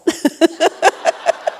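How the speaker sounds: airy burst of laughter, high; breathy laugh. Real, not acted. Wife laughing at the pass gas joke. Laughing at her own story.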